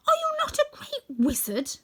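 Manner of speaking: very high-pitched incredulous question; crying out. A narrator's reading. Dorothy questions the wizard, high and astonished. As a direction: ask it in a high cry. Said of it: "cried Dorothy".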